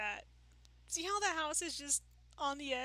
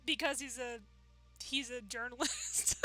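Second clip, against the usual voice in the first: ordinary talk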